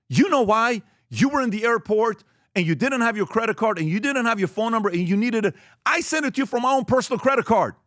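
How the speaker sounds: finance guy insisting to his boss that he helped; upset employee. Retold or imitated. Employee explaining the payment, upset.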